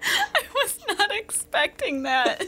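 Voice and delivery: high-pitched words through giggles; helpless laughter. Real, not acted. Co-host surprised by Cody's hello, helpless laughter. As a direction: barely get it out for laughing.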